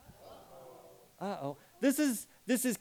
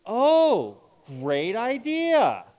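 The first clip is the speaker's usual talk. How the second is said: genuinely pleased, slow; mean it warmly; sincere enthusiasm; 5 semitones higher, 5 dB louder, much slower than usual. Shown as a demonstration. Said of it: "we would say".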